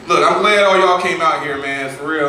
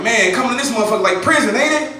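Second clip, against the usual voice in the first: imitating his own whining, higher; a mock complaint, pitched up; whiny complaining; 10 semitones higher than usual. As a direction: whine like a little bitch about work. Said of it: "I was just complaining".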